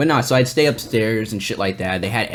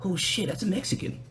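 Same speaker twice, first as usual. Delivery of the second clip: hushed inner thought; 8 semitones higher, 10 dB quieter than usual. Retold or imitated. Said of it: "where you're just kinda like".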